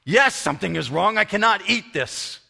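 put-on loud, high-pitched Hebrew-accented outrage; thick Hebrew accent. Upset man in wheelchair complains to visitor, thick Hebrew accent. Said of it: "in a very thick Hebrew accent".

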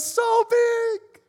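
put-on squeaky-high, loud child's shout; excited toddler. Toddler answering how much Mommy loves him, excited squeal. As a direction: squeal it out like a thrilled little kid. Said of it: "And he goes".

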